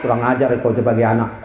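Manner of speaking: low, stern parental rebuke; mock-angry father, low pitch; scolding father. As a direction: imitate an offended dad telling off his son. Father scolds his son.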